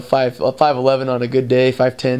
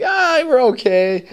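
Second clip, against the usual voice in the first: mock modest; 10 semitones higher, slower than usual. Feigned modesty to Russians.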